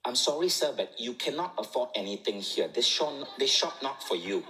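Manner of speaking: saleswoman mimicked in a Malaysian accent. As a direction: put on a Malaysian accent for a dismissive shop assistant. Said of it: "in a Malaysian accent".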